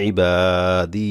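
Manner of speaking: very slow ইবাদি with a long final ই; ইবাদি ending on a held ই; stretched stop. Shown as a demonstration. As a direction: draw the last দি of ইবাদি out for one alif. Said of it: "এক আলিফ টান দিয়ে থামতে হবে".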